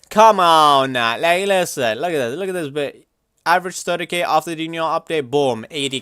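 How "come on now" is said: louder, slower: "Come on now" stretched out; drawn-out exasperated come on now. Real, not acted. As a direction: drag "Come on now" out in disbelief. Dismissing low player count.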